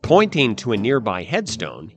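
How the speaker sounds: ordinary delivery, nothing marked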